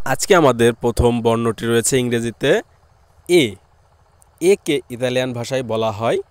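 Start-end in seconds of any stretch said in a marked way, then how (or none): none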